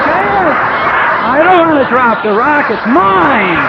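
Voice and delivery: loud, high whiny refusal; stubborn protest. Retold or imitated. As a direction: clutch it and refuse, loudly. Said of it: "I'm like".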